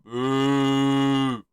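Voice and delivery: long, sustained boo; drawn-out mock jeer; imitated crowd boo. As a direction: boo like a disappointed arena. Mimics crowd booing.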